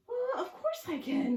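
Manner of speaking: high, loud giggly reply; giggling little witch. Acted. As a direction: laugh through the words merrily. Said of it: "giggles the little witch".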